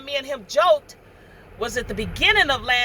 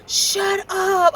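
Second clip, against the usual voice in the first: shocked disbelief; 7 semitones higher than usual. Retold or imitated. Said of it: "I was like".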